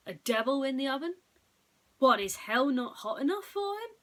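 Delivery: loud, high scoffing boss; mocking scorn. Boss scoffs at Boris's devil tale, loud and mocking. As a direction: sneer at the silly story. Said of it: "Mitchell snorted".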